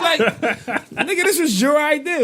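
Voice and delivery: laughing disbelief. Real, not acted. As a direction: crack up in disbelief. Laughing at the absurd blame.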